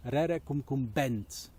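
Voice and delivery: ordinary delivery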